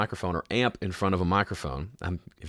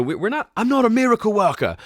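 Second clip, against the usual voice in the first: high, loud put-on exclamation; mock protest; 12 semitones higher, 5 dB louder than usual. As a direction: burst out in a comically high voice. Mock protest at impossible request.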